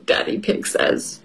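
usual speaking voice

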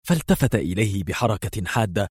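plain reading voice

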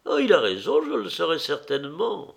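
high-pitched society lady; teasing old lady, high voice; lively old hostess. Acted.

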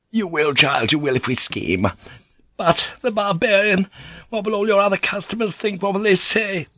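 rising to a high, worried madam's voice; scheme, then panic over the customers; Gyoko scheming then fretting. A narrator's reading.

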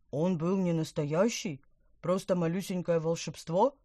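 loud outraged fish gurgle; indignant gurgling. Acted. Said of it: "От возмущения Помпон булькал".

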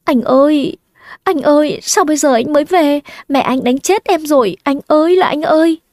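high-pitched, plaintive crying out to a lover; acted wailing. A narrator's reading. Mistress running to her lover after a beating, acted wail. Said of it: "thì khóc rú lên".

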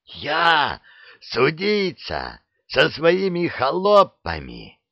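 slow, outraged nobleman's bark; furious. A narrator's reading. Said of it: "стала аж лиловой от ярости".

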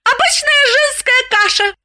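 proud declaration, voice up high; proudly. A narrator's reading. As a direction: announce it like a boast. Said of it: "с гордостью сообщила Галина". (с гордостью)

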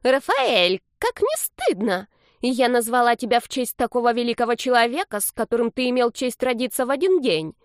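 high, theatrical scolding; reproachful granny. A narrator's reading. Grandmother shames grandson for arguing, high reproachful voice. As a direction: wail reproaches in a high old-lady voice. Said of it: "Старушка всплеснула руками".

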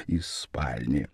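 plain reading voice

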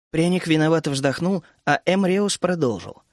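usual speaking voice

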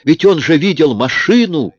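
high, loud indignant cry; angry exclamation. A narrator's reading. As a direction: she snaps back in outrage at the idea of police. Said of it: "сердито воскликнула она". (сердитый возглас)

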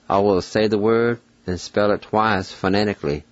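ordinary delivery

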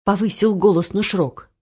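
ordinary delivery

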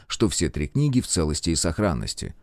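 ordinary delivery, nothing marked

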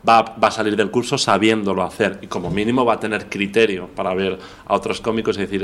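normal talking voice

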